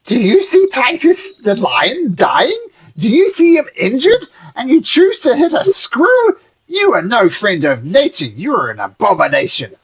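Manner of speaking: high-pitched gnome voice accusing; a small forest gnome lecturing angrily; indignant gnome. Acted. Gnome scolds the party.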